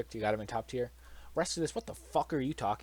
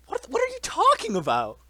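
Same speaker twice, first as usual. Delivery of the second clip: much higher and louder, outraged disbelief; incredulous shout; 11 dB louder than usual. Real, not acted. Ranting at a friend who put Kirby in high tier, loud incredulous shout. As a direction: blow up at the ranking.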